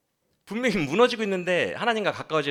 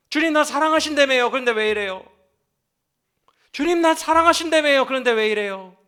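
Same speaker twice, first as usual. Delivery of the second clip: high, loud lamenting cry to God; crying-out prayer; 9 semitones higher, 7 dB louder than usual. Retold or imitated. Believers praying to God who loves them, high loud lament. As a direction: plead loudly like a wounded believer. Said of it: "여러분들도 이렇게 기도하잖아요".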